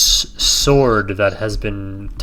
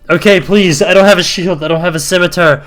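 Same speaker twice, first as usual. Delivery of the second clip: high, loud desperate pleading goblin; begging for my life; 6 semitones higher, 7 dB louder than usual. Acted. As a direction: beg frantically for your life. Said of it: "he starts to plead for his life".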